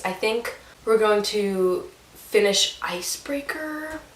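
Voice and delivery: a breathy whisper. Real, not acted. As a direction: whisper it like a secret. Whispered aside.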